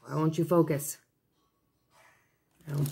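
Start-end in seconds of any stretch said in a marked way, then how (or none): none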